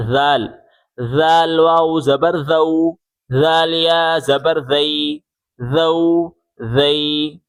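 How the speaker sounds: "ذال", "ذو", "ذی" with a voiced th as in this; tongue between the teeth for "ذال", endings drawn out. Shown as a demonstration. Teacher modelling the next letter, tongue-out voiced th. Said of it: "زبان باہر نکال کے پڑھیں".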